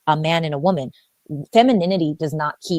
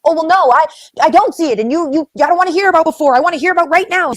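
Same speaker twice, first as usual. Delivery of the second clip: mock woman retort; 11 semitones higher, 8 dB louder than usual.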